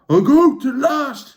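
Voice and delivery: high, slow delighted shout; excited cry. Acted. The Trawl spotting hoof prints, overjoyed cry. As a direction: cry out with joy at finding it. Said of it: "he cried".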